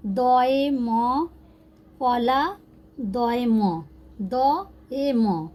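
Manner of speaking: slow, drawn-out spelling aloud; syllable-by-syllable reading. Shown as a demonstration. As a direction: stretch each Bengali letter name for learners. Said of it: "तो पढ़ा जाएगा दए मय".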